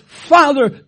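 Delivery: loud anguished cry to Father. Acted. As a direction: call out to heaven. Voicing Jesus's plea, loud cry.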